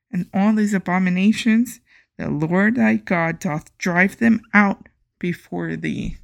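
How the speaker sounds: loud, solemn Bible reading; scripture reading. Acted. Podcaster reading scripture to listeners, solemn and loud. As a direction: proclaim the verses like a preacher.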